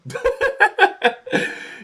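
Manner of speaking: high burst of real laughter. Real, not acted. Streamer recalls his friend quitting the game, laughing hard. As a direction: crack up helplessly.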